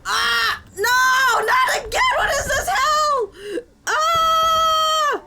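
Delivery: high, loud laughing-crying shrieks; terrified screaming. Real, not acted. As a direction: scream in mock horror, half laughing. Killed again, screaming.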